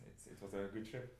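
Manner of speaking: ordinary delivery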